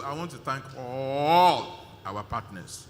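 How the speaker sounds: ALL drawn out long, swelling loud; slow down and swell on a long "all"; emphatic thanks. Real, not acted. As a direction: hold ALL, rising then falling.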